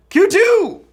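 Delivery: high, loud announcer call; hyped announcement. Acted. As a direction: announce the next round with a burst. Announcing next question.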